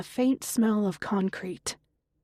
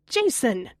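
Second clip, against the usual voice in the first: mother shouting back; 3 semitones higher than usual. A narrator's reading. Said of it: "Connie shouts back".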